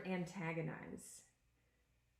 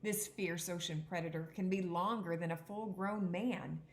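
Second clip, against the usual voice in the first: a clear, even read-aloud voice for the class; teacher reading a picture book aloud; reading aloud to children; 2 semitones higher than usual. Acted. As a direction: read the book to the kids, a touch dramatic.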